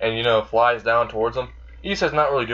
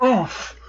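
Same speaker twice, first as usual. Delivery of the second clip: high, short mock groan; wounded oof; 11 semitones higher than usual. Azazel stung on the inside by Issei's remark, pained oof. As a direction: react as if punched.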